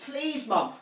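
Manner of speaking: put-on high, pestering childish plea; child's pleading. Child who can't get blackjacks, pleading with mum. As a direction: keep nagging mum, begging.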